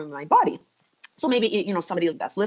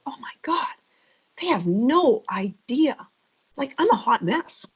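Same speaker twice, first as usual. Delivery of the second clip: higher, breathless "oh my God", then drawn-out despair; retold tearful breakdown; 5 semitones higher than usual. Mom alone in her car after praise, retold tearful despair. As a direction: mildly act out falling apart in private. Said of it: "I would break down into tears".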